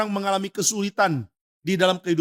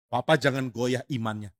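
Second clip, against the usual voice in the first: normal talking voice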